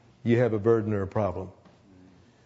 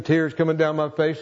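ordinary delivery

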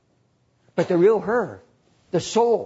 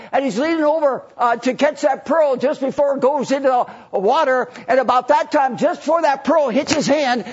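ordinary delivery

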